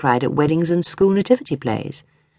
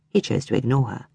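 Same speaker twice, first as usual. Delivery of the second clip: plain reading voice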